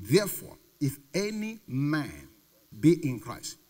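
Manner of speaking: slow, solemn scripture reading. Acted. Preacher reading the verse to the congregation, slow and solemn. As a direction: read the verse slowly and deliberately.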